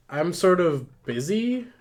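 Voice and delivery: put-on high, slow, drawn-out Valley Girl. [name] on her phone to Benny, Valley Girl voice. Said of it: "Oh, Valley Girl voice".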